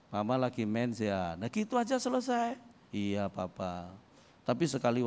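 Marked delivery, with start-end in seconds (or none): 0.1-1.4 gentle caring question. Retold or imitated. Husband's simple caring question. 2.9-3.9 much softer, low and slow "Iya, Papa"; soft sweet reply. Retold or imitated. Wife answers her caring husband, slow and very soft.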